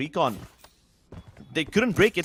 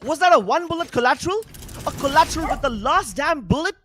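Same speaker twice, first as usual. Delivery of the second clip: high, loud amazed disbelief; amazed shout; 10 semitones higher, 6 dB louder than usual. Real, not acted. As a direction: yell it out in awe. Amazed at one-bullet collateral.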